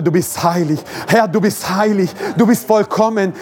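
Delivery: usual speaking voice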